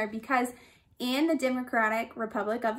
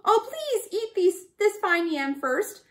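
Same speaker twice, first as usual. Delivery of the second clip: high pleading rat voice, much higher; begging; 7 semitones higher than usual. A narrator's reading. Bush rat begs the lion, high and pleading. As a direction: beg the lion to take the yam instead. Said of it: "begged Bush Rat".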